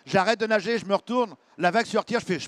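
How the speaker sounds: ordinary talk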